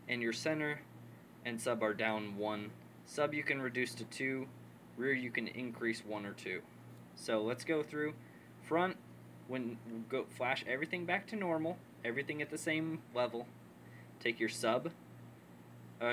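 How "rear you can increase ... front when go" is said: ordinary talk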